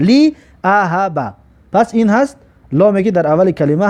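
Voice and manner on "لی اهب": لی اهب said slowly with a clear kasra, li; slow model reading. Shown as a demonstration. Teacher modelling the kasra reading slowly. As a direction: stretch لی اهب, stress the li. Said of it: "زیر در نظر داره".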